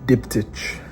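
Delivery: hushed late-night voice; quiet at night. Real, not acted. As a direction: keep it low, someone's sleeping. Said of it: "I'm a bit quiet because my wife is asleep".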